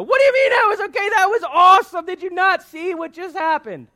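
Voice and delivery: high, fast, disbelieving shout; incredulous outraged fan. Acted. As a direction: yell at a friend who missed the greatness. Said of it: "smack that person on the back of the head and go".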